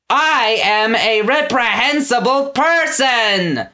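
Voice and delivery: high, slow, boastful shout; proud shout. A narrator's reading. Gristle boasting of his wickedness, proud shout. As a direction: crow it with pride. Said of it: "Gristle shouted with pride".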